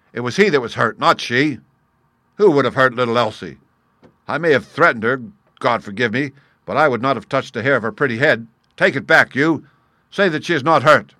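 loud, agitated protest; fierce cry. A narrator's reading. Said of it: "he cried fiercely".

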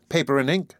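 usual speaking voice

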